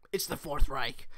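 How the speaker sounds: throat catching, a bit croaky; frog in throat. Real, not acted. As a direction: speak through a frog in the throat. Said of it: "Frog in my throat".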